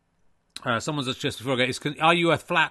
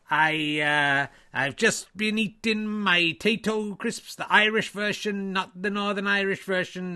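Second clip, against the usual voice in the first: hammed-up stage Irish lilt, pitched higher; exaggerated comic Irish accent; 5 semitones higher, 3 dB louder, slower than usual. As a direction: play a cheery Irish snooker player in a thick Irish accent. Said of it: "he's the Irish Eyes".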